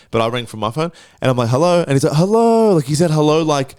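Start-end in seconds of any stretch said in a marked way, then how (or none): 2.1-2.7 much higher, louder retold hello; eagerly awaiting hello. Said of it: "I've been waiting for you to call". 3.1-3.4 raised, louder repeat of an imitated greeting; eagerly awaiting hello. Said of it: "I've been waiting for you to call".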